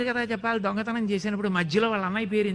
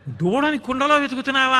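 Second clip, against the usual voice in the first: incredulous; 5 semitones higher, 6 dB louder than usual. Acted. Gopi's incredulous retort.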